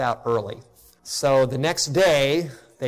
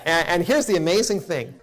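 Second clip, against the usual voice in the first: ordinary talk, nothing marked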